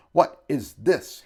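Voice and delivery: blustering. A narrator's reading. Shopkeeper outraged at the visitors, blustering. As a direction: bluster with every word punched. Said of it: "he blustered".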